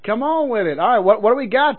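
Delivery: usual speaking voice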